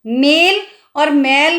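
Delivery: मेल with a short e, मैल with an open ai, said slowly; e versus ai vowel. Shown as a demonstration. Teacher contrasting मेल and मैल for pupils. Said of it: "उच्चारण अलग हो रहा है".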